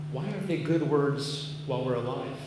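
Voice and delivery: ordinary talk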